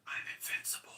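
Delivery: unvoiced, close whisper; whispered threat. Turk whispering to the janitor, a menacing whisper. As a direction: lean in and breathe it menacingly. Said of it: "leans in and whispers".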